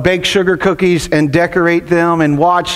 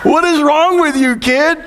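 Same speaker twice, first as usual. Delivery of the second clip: exasperated dad, higher pitched; retold exasperation; 7 semitones higher than usual. Dad reacting to his son's antics, retold high-pitched exasperation. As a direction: act out a frustrated parent's disbelief. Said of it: "I was so angry and so frustrated".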